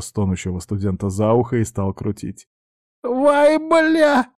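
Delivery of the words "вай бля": pained yelp. Acted. Ear being twisted, yelp.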